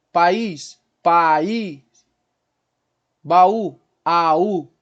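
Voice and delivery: "País" and "Baú" loud, vowels split; hiatus demo. Shown as a demonstration. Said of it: "Então eu tenho duas vogais". ("País" e "Baú" com as vogais bem separadas)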